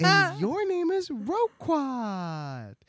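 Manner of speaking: high, sing-song reveal of his name; coaxing reveal. Acted. Ozma telling the Gnome King his name, high and coaxing. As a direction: tease it out like a game. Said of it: "Ozma's like".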